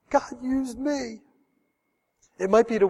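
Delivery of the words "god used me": slow, soft wonder; awed. Pretend. Soul winner amazed, slow and soft. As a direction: hush it in amazement.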